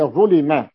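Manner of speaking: "ظلم" as ẓulima; passive vowelling. Shown as a demonstration. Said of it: "ضبطت هنا".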